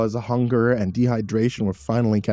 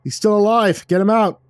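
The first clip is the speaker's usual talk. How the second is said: urgent shout; 11 semitones higher, 7 dB louder than usual. Acted. Rescuer calls out finding survivor.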